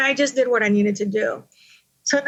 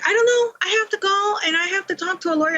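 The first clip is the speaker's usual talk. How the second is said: much higher, dismissive mom voice; mother brushing off her daughter on the phone, high and dismissive; imitating mom; 9 semitones higher, faster than usual. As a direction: toss the blame back like an exasperated mother.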